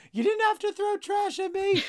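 put-on very high squeaky kid complaining; high-pitched child protesting about trash thrown at him; child's voice. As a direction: whine up like an offended little urchin. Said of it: "they go".

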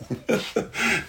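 breathy high acted chuckle; Daniel's laugh. Preacher acting out Daniel laughing at the king, amused chuckle. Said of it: "Daniel starts laughing".

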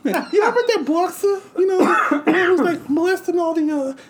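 high squeaky lisping Tyson voice, way up; Mike Tyson impression. Tyson doing his monologue to the crowd, in a high squeaky voice. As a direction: mimic Tyson's high voice hard. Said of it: "Mike Tyson comes out".

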